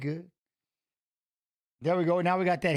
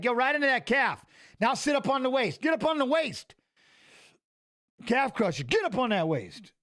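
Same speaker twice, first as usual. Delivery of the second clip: urging on; 5 semitones higher than usual. Real, not acted. Cheering on a fighter.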